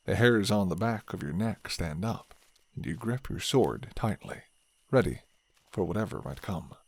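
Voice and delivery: usual speaking voice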